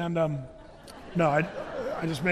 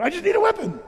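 higher and louder, pushing past the question; insist, desperate and urgent; the general brushing off the priest's question; insistent frantic; 10 semitones higher, 5 dB louder, faster than usual. Retold or imitated.

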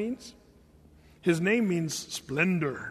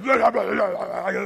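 mock death-metal growl; death metal vocals; 2 semitones higher, 4 dB louder than usual. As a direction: growl nonsense like a thrash singer. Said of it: "That's how he's saying".

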